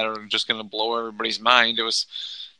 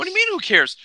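high, loud disbelieving yelp; incredulous; 5 dB louder, faster than usual. Real, not acted. Co-host arguing with his partner, high incredulous outburst. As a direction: jump up in disbelief at him.

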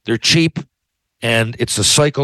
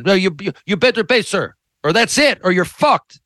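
mock threatening agent; 7 semitones higher than usual. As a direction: ham up a rude agent threatening him. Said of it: "agent's threatening reply acted".

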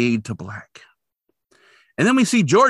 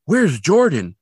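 higher, louder worried call, lightly acted; mild mock worry; 6 semitones higher, 5 dB louder, slower than usual. Recapping worried Catherine looking for Jordan, mildly urgent. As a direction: imitate a mother starting to worry. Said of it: "every time she sounds more urgent and fearful".

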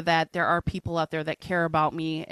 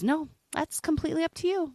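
imitating a condescending nurse, pitched much higher; mock the nurse's talking-down tone; sing-song high imitation of a patronising nurse; 11 semitones higher than usual. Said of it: "with a condescending tone".

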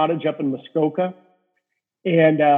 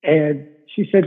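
ordinary talk, nothing marked

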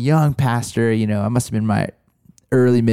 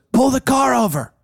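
higher, blunt commanding voice; blunt New York mentor; 11 semitones higher than usual. Retold or imitated. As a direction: order the driver to stop like a no-nonsense mentor. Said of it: "kinda New York ways".

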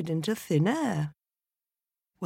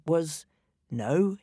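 usual speaking voice

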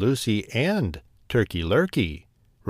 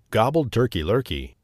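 usual speaking voice

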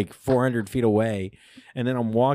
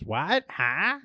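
high, confused grandma asking what; deaf grandma; 7 semitones higher than usual. Retold or imitated. Grandma in the bathroom mishears, high and puzzled. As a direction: call out like she can't hear through the door. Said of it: "and she just goes".

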